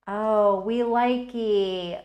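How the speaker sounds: loud, slow gleeful coo; delighted. Real, not acted. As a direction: squeal it out slowly, thrilled with the result. Delighted reaction to her brushwork.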